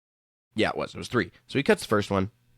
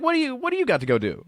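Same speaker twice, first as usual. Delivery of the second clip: fast, high incredulous jab; mock exasperation; 13 semitones higher, faster than usual. Patient mocking the dentist, fast and high. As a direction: fire it off, fed up.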